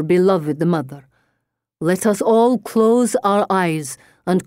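slow, foreign-tinged séance voice; theatrical medium. Acted. Medium to a grieving girl before an audience, slow solemn showmanship. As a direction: preside gravely over a séance, drawing words out. Plays the medium Madame Romanoff.

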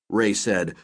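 plain reading voice

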